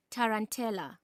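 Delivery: rhythmic, lilting poetry reading; reading a poem aloud to a class with a swinging rhythm; poem recitation. Acted. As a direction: recite it with the beat of the dance. Teacher recites poem Tarantella.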